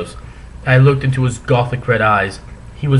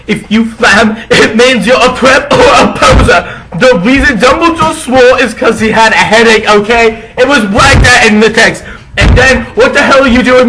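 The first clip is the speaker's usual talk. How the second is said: hammy stage actor; 9 semitones higher, 8 dB louder than usual. Acted. Author's note ranting at critics.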